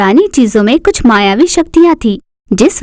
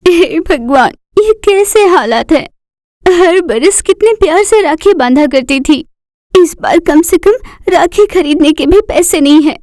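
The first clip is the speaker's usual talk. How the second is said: put-on higher girlish voice, anxious lament to God; a young girl fretting over having no money for a rakhi; worried daughter; 5 semitones higher than usual. Daughter praying to God in despair, in a high anxious voice.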